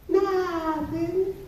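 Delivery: put-on high sing-song little kid denial; innocent kid. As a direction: squeak it out like a guilty toddler. Kid voiced denying.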